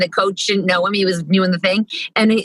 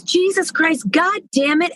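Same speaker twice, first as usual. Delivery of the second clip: put-on higher-pitched exasperated little kid; imitated swearing child; 5 semitones higher, slower than usual. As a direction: curse like a frustrated preschooler. Four-year-old's swearing acted out.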